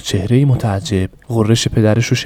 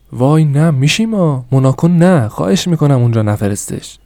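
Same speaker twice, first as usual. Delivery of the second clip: pleading mother; 5 semitones higher, 3 dB louder than usual. Acted. Mother begging husband to spare son, high pleading. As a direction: beg him not to send the boy.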